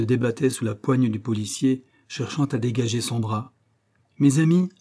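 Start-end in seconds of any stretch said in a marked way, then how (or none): 4.2-4.7 higher and louder call to the men; urgent appeal. Acted. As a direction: raise the voice to reach them. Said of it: "repartit Paulin Broquet". (appel pressant, plus haut et plus fort)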